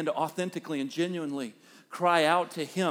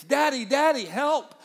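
put-on higher, louder child's cry for daddy; imitated scream for help; 7 semitones higher, 4 dB louder than usual. Hurt daughter calling her father from her room, imitated urgent scream. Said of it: "scream out differently".

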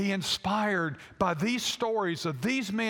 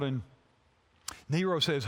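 usual speaking voice

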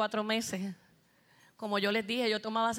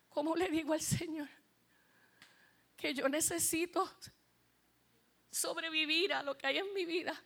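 pained pleading; 5 semitones higher than usual. Real, not acted. Relives her despair before God.

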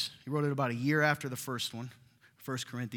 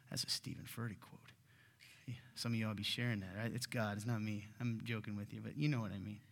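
quiet aside; 4 semitones lower, 8 dB quieter than usual. Real, not acted.

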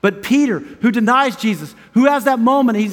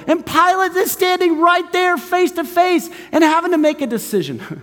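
high, stretched-out sermon cries; impassioned preaching; 9 semitones higher than usual. Real, not acted. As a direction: proclaim the good news to the whole room. Preaches at full force.

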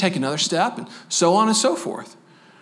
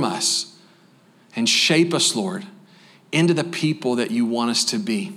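closing prayer; 3 semitones lower than usual. Acted. Closing prayer over congregation.

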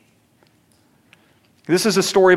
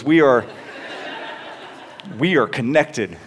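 laughing. Real, not acted. Awkward foot washing memory.

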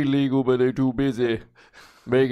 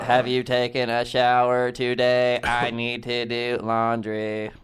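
slow, dopey robot voice; dumb robot voice. Pretend. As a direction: mumble it like a dim robot. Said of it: "so that's what he does".

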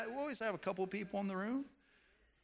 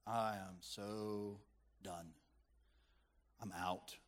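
slow, soft, much lower, drained; exhausted; 8 semitones lower, 8 dB quieter, slower than usual. Retold or imitated. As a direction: let it sink out like you're spent. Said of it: "you just feel like".

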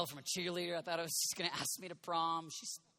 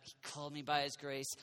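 softer, lower, tender repeat; savoring; 3 semitones lower, 3 dB quieter than usual. Shown as a demonstration. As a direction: linger over the words quietly. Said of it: "I like that".

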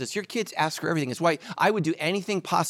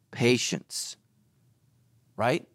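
usual speaking voice

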